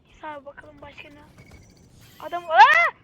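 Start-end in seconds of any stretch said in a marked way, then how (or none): 2.2-2.9 startled. Real, not acted. Sees an enemy suddenly.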